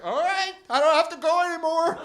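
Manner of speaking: high, loud delighted reaction; retold glee. Comic tells crowd how he took the news, gleeful. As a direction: act thrilled, pitched well up. Retells elated reaction to rule.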